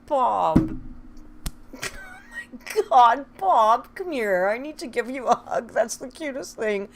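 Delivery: loud, high gushing over a sweet story; gushing excitement. Real, not acted. Streamer gushes loudly to a kind viewer, delighted. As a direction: practically squeal it with delight.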